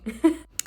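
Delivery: quick rising giggle; bright little laugh; short laugh. Real, not acted. Laugh after the intro.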